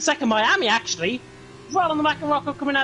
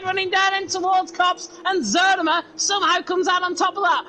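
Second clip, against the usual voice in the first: motorsport commentator; 5 semitones higher than usual. Real, not acted. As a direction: call the overtake with building excitement. Close battle called live.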